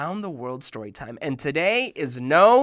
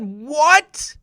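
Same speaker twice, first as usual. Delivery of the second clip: higher, louder shocked what; 10 semitones higher, 10 dB louder than usual. Retold or imitated. Boy stunned by the news, shouting what. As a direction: react in disbelief. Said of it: "I said".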